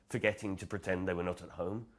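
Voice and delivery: usual speaking voice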